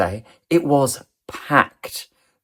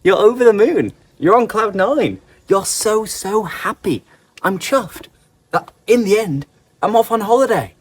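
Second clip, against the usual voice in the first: high, happy lift on each phrase; acted delight; 7 semitones higher than usual. Teacher showing learners what chuffed means, delighted delivery. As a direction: sound over the moon, pitch raised. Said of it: "If you are chuffed".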